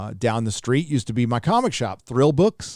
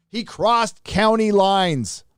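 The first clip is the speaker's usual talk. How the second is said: slow deliberate repeat; 7 semitones higher, much slower than usual. Acted. Dramatic reread of donor message.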